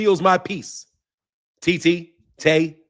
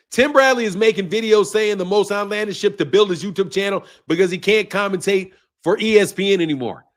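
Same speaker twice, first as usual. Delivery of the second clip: usual speaking voice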